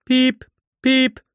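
high, loud device beeps; imitated electronic beep. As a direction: beep like an alarm gadget. Narrator imitates the device beeping. (hohes, lautes Gerätepiepen)

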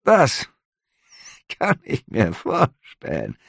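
high sly chuckle; chuckling. A narrator's reading. Innkeeper teasing the officer, sly chuckle. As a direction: tease him with a snicker. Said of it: "der Wirt kicherte".

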